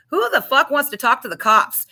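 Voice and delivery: sharp, loud and high, snapping at the idea of cops; pissed-off retort. Retold or imitated. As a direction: fire it back like a furious addict woken up. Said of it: "at first was pissed".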